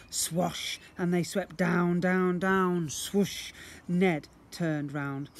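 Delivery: ordinary delivery, nothing marked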